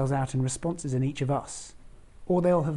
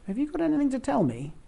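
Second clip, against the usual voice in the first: high, probing schoolboy question; child Joseph; 10 semitones higher than usual. Retold or imitated. Boy Joseph quizzing Mary, suspicious. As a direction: ask like a suspicious little boy.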